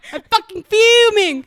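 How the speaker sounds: slow furious mock British shout; British accent. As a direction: bellow it like an angry Brit. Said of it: "Speaking of British".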